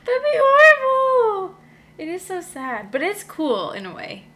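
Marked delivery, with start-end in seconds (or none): 0.0-1.5 hammed-up high, loud, drawn-out cry; mock wail. Host reacting to having no sequel, mock wail. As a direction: wail it slowly in mock despair. Joking lament about no sequel.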